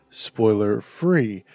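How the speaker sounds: usual speaking voice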